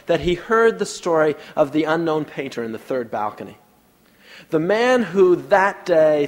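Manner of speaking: usual speaking voice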